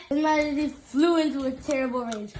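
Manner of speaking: ordinary delivery, nothing marked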